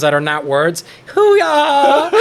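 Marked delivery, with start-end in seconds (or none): 1.1-2.1 high loud exclamation; Uncle Roger impression. Mimicking Uncle Roger's catchphrase.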